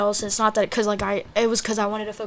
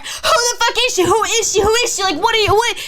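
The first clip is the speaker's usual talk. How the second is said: high, loud, repeated frantic demand; screaming; 12 semitones higher, 5 dB louder than usual. Retold or imitated. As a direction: reenact a hysterical interrogation. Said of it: "I kept screaming".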